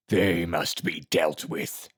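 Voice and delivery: very slow, low, gravelly master. A narrator's reading. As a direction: drag it out in a gravelly growl. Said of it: "spoke in his low, gravelly voice".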